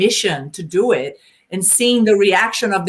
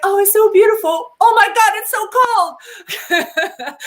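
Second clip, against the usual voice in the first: giddy tourist; 11 semitones higher, 4 dB louder than usual. Retold or imitated. Tourist arriving in cold Norway.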